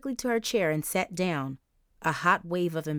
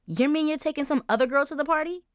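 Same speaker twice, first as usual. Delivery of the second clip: put-on high demanding girl voice; 9 semitones higher, 4 dB louder, faster than usual. Charisse demanding an answer.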